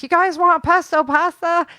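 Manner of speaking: mildly recreate a yell to friends up there; retold calling out. Offering friends pesto pasta, mock calling out. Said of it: "I was yelling up there".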